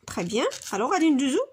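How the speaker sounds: ordinary talk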